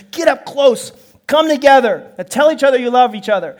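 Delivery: acted stern insistence; insistent parent. Parent ordering reluctant children.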